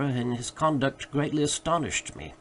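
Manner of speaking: usual speaking voice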